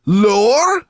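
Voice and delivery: high, loud rising question; incredulous one-word outburst; appalled echo. A narrator's reading. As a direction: shriek it back in disbelief. Sorrel is appalled.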